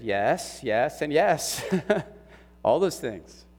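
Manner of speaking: rising laugh on "yes, and yes"; laughing admission. Real, not acted. Pastor admits doubt to friend, laughing. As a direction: let the confession spill into a laugh.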